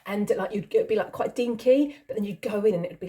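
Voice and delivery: usual speaking voice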